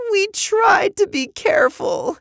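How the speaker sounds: very high, loud tearful wail; crying. A narrator's reading. Girl confessing to the scientist, high tearful cry. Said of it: "Eva started crying".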